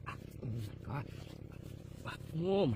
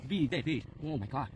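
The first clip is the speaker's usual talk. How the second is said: usual speaking voice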